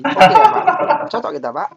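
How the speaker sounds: high, loud mock-chummy patter; winking student. Student winking at the examiner, hammed-up and cheeky. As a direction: overplay a cheeky appeal to the examiner. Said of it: "kedip-kedip mata".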